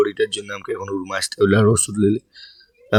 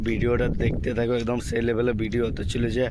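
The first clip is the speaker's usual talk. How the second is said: much softer, voice not coming out; sore-throat voice; 11 dB quieter than usual. Real, not acted. Vlogger apologising to viewers for a bad throat, weak quiet voice. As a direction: greet viewers softly through a worn-out throat. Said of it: "আজ গলা বসে গেছে".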